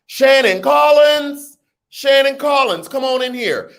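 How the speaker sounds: high, loud cheerful praise; high excited shout-out; hype shout-out. Real, not acted. Welcoming a supporter.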